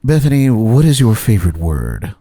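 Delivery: lightly voiced soothing interviewer; a hint of formal TV questioner; James Lipton impersonation. Host puts guest on the hot seat, lightly impersonated interviewer. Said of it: "It's my best James Lipton impersonation".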